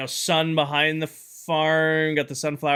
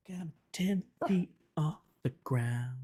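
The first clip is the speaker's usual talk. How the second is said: whisper; 11 dB quieter than usual. Real, not acted. Whispered aside during gameplay.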